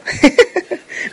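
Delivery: short high laugh at her own joke. Real, not acted. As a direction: let out a quick high laugh. (risa corta y aguda)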